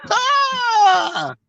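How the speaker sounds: sudden high shouted cry; sharp cry. Retold or imitated. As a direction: yelp out in anguish. Wife's angry outburst retold.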